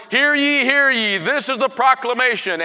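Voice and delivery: loud, high town crier call; herald's proclamation. Acted. Herald runs into town with news, loud proclamation. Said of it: "a herald making a loud proclamation".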